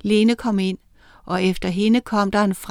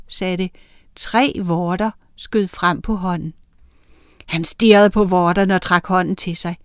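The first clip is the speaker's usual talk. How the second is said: usual speaking voice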